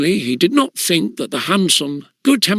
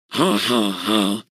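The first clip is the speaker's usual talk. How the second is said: laughing; 6 semitones lower, 3 dB quieter, slower than usual. Acted. Juniors laughing at Bunter.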